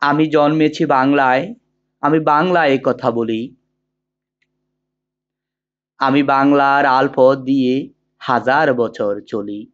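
measured, sustained poetry recital with drawn-out phrase endings; poem recitation. Acted. Teacher reciting the poem Amar Porichoy for students, in measured declamation. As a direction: declaim the verse slowly and evenly, pausing between couplets.

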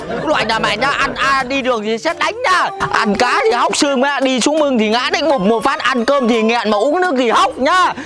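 slow, deliberate spell of bad luck; man chanting curses at the shirt thief; slow cursing. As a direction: lay a curse word by word. Slow ritual curse on the culprit.

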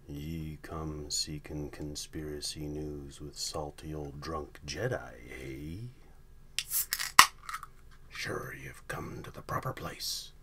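whispered salty pirate drawl; hushed old sea dog, conspiratorial; gruff old fisherman. Acted. Pirate-talk whispered conspiracy intro.

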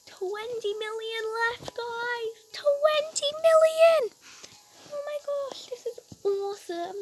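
high-pitched thrilled gamer cheer; real excitement, voice shooting up; excited. Real, not acted. Gamer nearing a big milestone.

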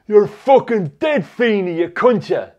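loud, high, slow thickheaded threat; dumb, inarticulate bully. Retold or imitated. Infuriated bully threatens the schoolboy, loud and dumb-sounding. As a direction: mimic a dopey, infuriated lout. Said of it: "he'd say in that dumb, inarticulate gob".

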